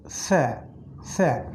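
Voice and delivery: স্যা said as a soft lisped sya; Arabic letter sound. Shown as a demonstration. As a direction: teach স্যা with the tongue tip at the teeth. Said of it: "এর নাম হলো স্যা".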